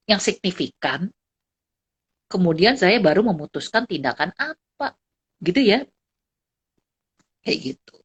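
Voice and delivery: usual speaking voice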